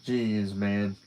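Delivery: ordinary delivery, nothing marked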